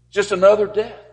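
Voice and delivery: high and loud, outraged; mock indignant cry. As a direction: throw the dismissive words back loudly. Mocking dismissive view, loud contrast.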